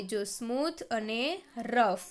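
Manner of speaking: ordinary talk, nothing marked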